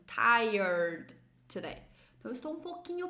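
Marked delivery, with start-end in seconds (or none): 0.1-1.8 "tired" stretched out very slowly and firmly; pronunciation demo. Shown as a demonstration. Teacher shows how "tired" is pronounced, slow and loud. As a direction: draw out "tired", then "today". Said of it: "Ó, essa pronúncia".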